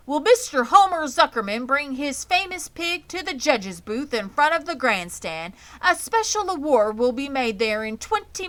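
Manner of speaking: high, loud public address call; loudspeaker announcer. A narrator's reading. Fair announcer summons Zuckerman to the judges' booth. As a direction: announce it over the fairground speakers. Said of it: "a voice was heard on the loudspeaker".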